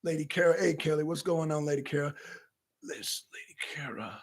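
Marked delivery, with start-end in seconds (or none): 2.8-4.2 whisper. Real, not acted. Greeting viewers in a whisper.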